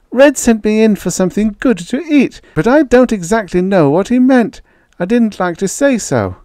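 put-on a timid boy asking for help; shy boy Slim. A narrator's reading. Narrator voices the boy Slim.

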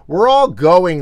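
loud, high storybook cheer; delighted burst of good news; gleeful exclamation. A narrator's reading. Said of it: "laughed Ferdy".

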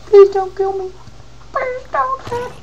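high-pitched pleading squeal; mock pleading. Player begging a creeper, high mock pleading. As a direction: beg for your life in a squeaky voice.

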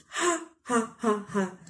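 soft, breathy short laughs; a shaky half-laugh; mother reacting to the money, shaky laugh; harsh laugh. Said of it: "Ma said in a harsh voice".

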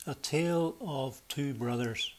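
normal talking voice

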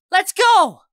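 high, loud rallying shout; yelling. A narrator's reading. Friend calls team to retreat, yelling. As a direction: yell it to get everyone moving. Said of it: "Puppy yells".

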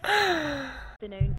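ordinary talk, nothing marked

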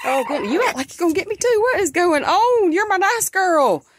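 high loud coaxing sing-song; talking to a hen. Real, not acted. Speaking to the hen, high loud coaxing sing-song. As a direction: coo up high at the hen like a pet.

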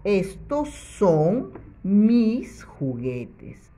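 slow, clearly spaced reading; slow model sentence. Shown as a demonstration. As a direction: read it slowly so children follow. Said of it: "para que diga".

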